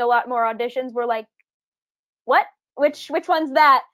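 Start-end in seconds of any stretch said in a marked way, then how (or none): 2.3-3.8 retold puzzlement. Retelling her puzzled reaction.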